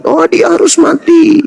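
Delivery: high-pitched stubborn protest; mock insistence. Preacher mimicking opponents who demand a death, mock insistence. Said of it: "itulah sehingga kalian itu ngotot".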